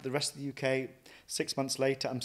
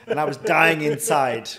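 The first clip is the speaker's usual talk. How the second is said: laughing; 5 semitones higher, 15 dB louder than usual. Real, not acted.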